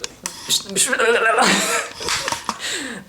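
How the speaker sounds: high, giddy squealing laugh; wordless excited squeal. Real, not acted. Traveler overwhelmed by the new place, squealing wordlessly. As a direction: squeal with delight, beyond words. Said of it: "literally no words".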